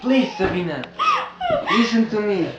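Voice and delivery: desperate pleading. Acted. George begs Sabina to listen, loud desperate pleading. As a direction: beg her to hear you out.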